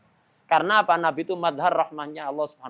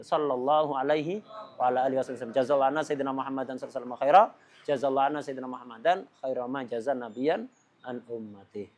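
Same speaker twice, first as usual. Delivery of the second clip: low, softer devotional recitation; salawat recitation; 5 semitones lower, 4 dB quieter, faster than usual. Real, not acted. Preacher recites blessings on the Prophet, low devotional recitation.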